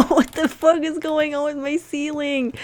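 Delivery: high, laughing disbelief; shocked exclamation. Real, not acted. Shocked at ceiling noise.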